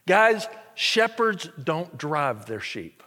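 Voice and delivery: ordinary delivery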